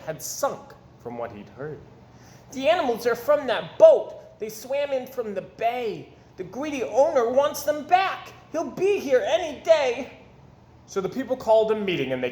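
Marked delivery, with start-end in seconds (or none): 2.5-10.1 put-on higher, breathless young messenger; urgent Little Red. A narrator's reading. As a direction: rush the warning out like a boy. Little Red delivers the news.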